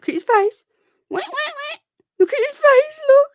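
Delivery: very high loud squealing coo. Real, not acted. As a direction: gush over the rabbit's face. Cooing at the pet.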